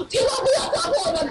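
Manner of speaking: high, laughing cry of alarm; panicked plea. Retold or imitated. Sister Lin begging the husband to spare the glasses, mock panic. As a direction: plead half-laughing not to break them.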